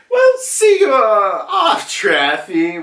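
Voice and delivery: high shouted farewell, drawn out at the end; big cheery send-off holler; called-out goodbye. Retold or imitated. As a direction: call goodbye across the water like an excited captain. Luffy calls goodbye to Law.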